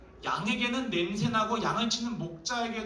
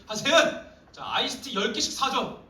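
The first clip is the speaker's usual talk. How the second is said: loud, higher barked order; bossy demand; 4 semitones higher, 5 dB louder than usual. Shown as a demonstration. Redo of the demand to Se-eun, now loud and bossy. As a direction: order her around with no reason. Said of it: "내가 다시 할게".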